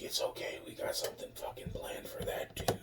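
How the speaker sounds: unvoiced whisper, secretive; conspiratorial whisper. Vlogger whispering a secret plan to viewers. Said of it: "And it's like".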